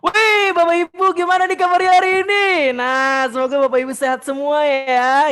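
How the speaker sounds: put-on overdone shouted greeting to an older crowd; too loud. Shown as a demonstration. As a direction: blast it out, high and very loud. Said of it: "jangan kenceng-kenceng". (sapaan heboh terlalu kencang)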